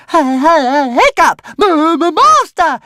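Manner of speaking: very high, loud stammering squeak; a tiny dragon squealing with excitement. Acted. Toothless greeting his master, excited squeaky dragon. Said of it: "he squeaked".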